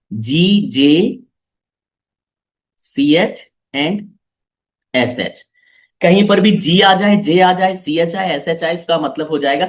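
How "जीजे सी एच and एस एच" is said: "जीजे सी एच and एस एच" spelled very slowly, letter by letter; slow clear dictation. Shown as a demonstration. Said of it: "साफ साफ लिख देता हूं मैं". (धीरे-धीरे साफ़ अक्षर बोलना)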